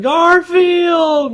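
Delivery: very high, drawn-out exasperated yell; imitating Jon's yell. Imitating Jon yelling at his cat, high drawn-out yell. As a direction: howl the name like Jon in the comic. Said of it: "John's always going".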